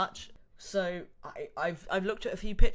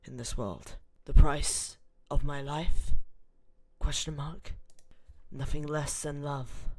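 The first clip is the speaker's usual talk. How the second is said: dramatic narration; 3 semitones lower, 5 dB louder than usual. Acted. Gamer narrating the intro as a dramatic story. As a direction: read it like a movie trailer intro.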